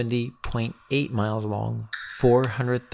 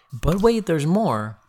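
infomercial announcer; 6 semitones higher, 3 dB louder than usual. Pretend. Infomercial catchphrase.

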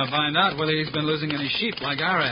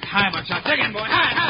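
shouting; 8 semitones higher, 3 dB louder than usual. Acted. Riders urge horses fast.

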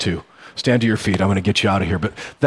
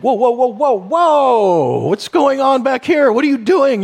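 high, loud, alarmed voice of a spooked donkey; panicked donkey; 11 semitones higher, 3 dB louder than usual. Pretend. Donkey to its rider, startled and freaking out. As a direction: yelp it out like a startled young donkey. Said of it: "That little donkey ... would be like".

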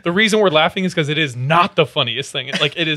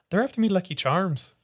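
Irish accent; 6 semitones higher, 3 dB quieter than usual. Shown as a demonstration. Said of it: "if I did an Irish accent".

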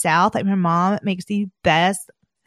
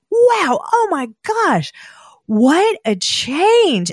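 much higher, drawn-out wow of amazement; amazed; 9 semitones higher, much slower than usual. Real, not acted. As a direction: stretch each word out, truly amazed. Amazed at body change.

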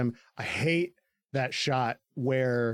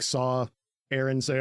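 normal talking voice

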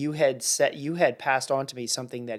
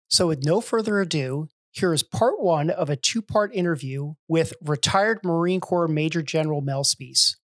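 higher, louder announcer-style intro; podcast intro read; 4 semitones higher, 6 dB louder than usual. Acted. Host opening the episode for listeners, in a louder, higher announcer read. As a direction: present the guest like a show host.

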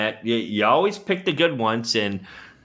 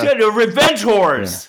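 high-pitched, exasperated insistence; insistent; 9 semitones higher, 3 dB louder than usual. Real, not acted. Cohost repeats his misunderstood revenge horrors suggestion, insistent and high. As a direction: push it out high, insisting they understand. Insists when nobody understands him.

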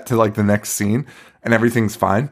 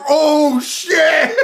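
much higher, laughing exclamation; mock shocked cry. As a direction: react like a student who just saw the monkey. Front row turns to monkey.

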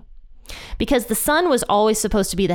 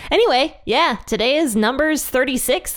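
usual speaking voice

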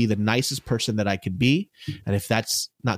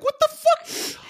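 incredulous squeal; slower than usual. Retold or imitated. Exasperated retelling of fans' reaction.

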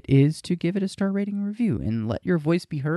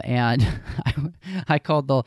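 ordinary talk, nothing marked

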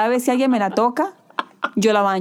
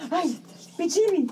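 high, slow, soft coo; 9 semitones higher, 6 dB quieter, slower than usual. Real, not acted. Mother cooing to her baby.

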